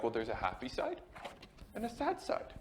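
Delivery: mock-gentle counselor explanation; hammed-up counselor. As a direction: imitate a hushed, coaxing therapist. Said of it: "He didn't talk like this".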